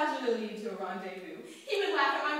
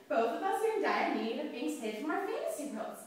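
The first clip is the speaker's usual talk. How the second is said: plain reading voice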